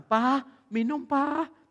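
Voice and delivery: put-on slow, high child calling for a parent; small child. Little child bringing papa a drink, high childlike voice. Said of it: "anak kecil datang".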